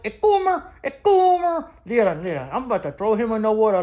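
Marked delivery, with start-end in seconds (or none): 0.1-1.7 high, louder teasing yell; high-pitched teasing shout of "A coomer!"; mock shout. Real, not acted. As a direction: squeal it out to tease him. Mocking shout at host.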